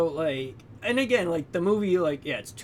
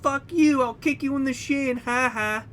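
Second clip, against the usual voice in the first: much higher and louder taunting brat; higher, louder mock taunt from the prick kid; bratty kid; 10 semitones higher, 4 dB louder than usual. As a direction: squeal it like a nasty little kid. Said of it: "Every time he shows up".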